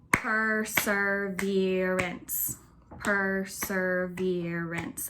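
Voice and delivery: Perseverance said very slowly, syllable by syllable; slow syllable count for the class; clapping out syllables. Shown as a demonstration. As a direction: clap out "Perseverance" slowly, one clap per syllable. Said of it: "Let's see how many syllables that word has".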